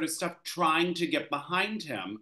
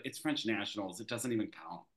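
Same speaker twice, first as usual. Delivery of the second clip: low, soft, unimpressed quote; mildly acted shrug-off; retold dismissiveness; 5 semitones lower, 7 dB quieter than usual. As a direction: wave it off quietly in a lowered pitch. Skater retold dismissing his big score.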